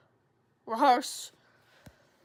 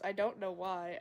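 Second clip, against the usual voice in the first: usual speaking voice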